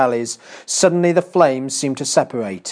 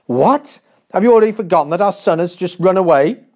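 fast, a touch louder, indignant queen; angry exclamation; 5 semitones higher, 4 dB louder, faster than usual. A narrator's reading. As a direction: snap at the forgetful king. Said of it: "looked at him in anger and exclaimed".

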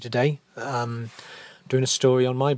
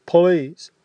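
sad plea; 5 semitones higher, 5 dB louder than usual. Acted. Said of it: "he asks sadly".

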